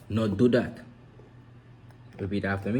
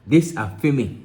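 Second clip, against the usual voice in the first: higher, louder Creole line; Jamaican Creole; 6 semitones higher, 8 dB louder than usual. Shown as a demonstration. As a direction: say it the island way. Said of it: "In Creole, you say".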